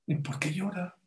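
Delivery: normal talking voice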